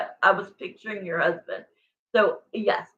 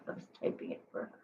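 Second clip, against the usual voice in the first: whisper; 18 dB quieter, much faster than usual. Real, not acted. Quick unvoiced aside.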